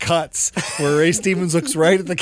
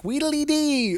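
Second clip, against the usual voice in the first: nasal high elf voice; 11 semitones higher, slower than usual. Retold or imitated. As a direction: pinch it up high through the nose like a tiny elf. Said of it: "Just this nasally, high-pitched".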